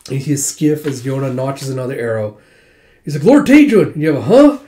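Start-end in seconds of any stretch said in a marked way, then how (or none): 3.2-3.9 high, loud cry of his name; warning shout. Retold or imitated. Soldier to his lord under attack, warning shout. 4.2-4.6 loud, high rising "huh?"; startled huh. Retold or imitated. Lord hearing a sudden warning, startled.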